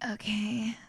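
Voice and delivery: whispered sultry okay; a soft seductive whisper; breathy whisper. Shown as a demonstration. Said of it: "What's your sex podcast voice?".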